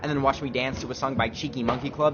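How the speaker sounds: mock solemn speech. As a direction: deliver it like an award speech. Mock ceremonious closing address.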